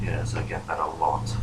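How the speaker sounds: low, slow whispery voice; amused phantom. Acted. Phantom replying to an insult, low, slow and whispery. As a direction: answer softly in a deep hush. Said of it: "he chuckles slightly and goes".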